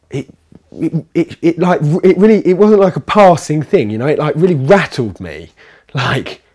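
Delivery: blurting out how rattled he was; shaken excitement. Real, not acted. Retelling shock at the smell.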